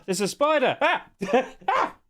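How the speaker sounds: high, giggly fright at a spider; startled, high-pitched laughing; laughing alarm. Real, not acted. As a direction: yelp and dissolve into laughter. Spots a spider while streaming.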